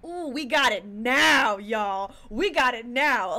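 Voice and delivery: slow, loud triumphant crowing; loud, slow victory gloat at the game; excited gloat. Real, not acted. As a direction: savour the win, drawn out and loud. Celebrating solving the puzzle.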